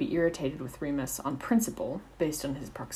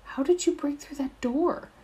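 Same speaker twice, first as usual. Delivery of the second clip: horrified; 11 semitones higher, 4 dB louder than usual. A narrator's reading. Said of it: "horror etched in the lines of her face".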